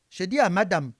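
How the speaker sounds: ordinary talk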